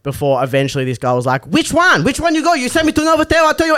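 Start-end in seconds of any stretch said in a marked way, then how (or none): 1.5-3.8 high, insistent Thai driver voice; angry Thai driver. Retold or imitated. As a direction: play the cabbie demanding which hotel. Thai driver confronting, voiced by teller.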